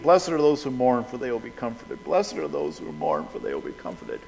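repeating softly, fading. Retold or imitated. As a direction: murmur the verse to yourself over and over, dying away. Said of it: "I literally was repeating it".

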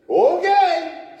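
high, loud, drawn-out okay; sudden resigned agreement; startled okay. Retold or imitated. Agrees to do nursing home wedding.

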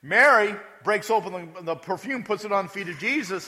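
usual speaking voice

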